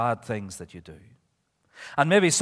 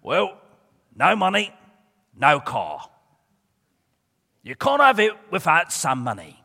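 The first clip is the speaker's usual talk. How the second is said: put-on loud, raised Bucknell accent; Mister Weasel; 4 semitones higher, 7 dB louder than usual. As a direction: lay down terms loudly in the weasel's accent. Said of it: "Well, Mister Weasel said to him".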